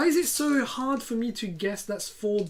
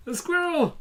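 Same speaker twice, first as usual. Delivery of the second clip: higher excited echo; 12 semitones higher, 3 dB louder than usual. Real, not acted. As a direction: echo the cry gleefully. Echoing the squirrel cry.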